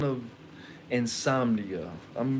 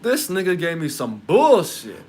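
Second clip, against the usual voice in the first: alarmed outburst; 5 semitones higher, 10 dB louder, slower than usual. Retold or imitated. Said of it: "I start hearing voices".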